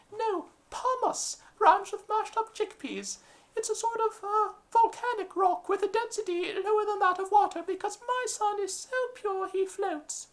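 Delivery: very high, prim English lady calling; shrill posh mother summoning her son. Acted. English lady calling her son down the street, very high voice.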